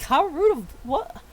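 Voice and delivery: high, posh gasping indignation; pearl-clutching outrage. Acted. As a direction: clutch your pearls, scandalised and lost for words. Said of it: "clutching their pearls, like".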